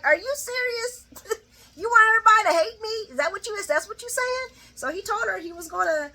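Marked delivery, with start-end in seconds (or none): 0.0-4.6 high-pitched mock outrage; high, indignant retelling, bursting louder midway; incredulous. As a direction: act out stunned disbelief, pitched way up. Voices his stunned reaction to her.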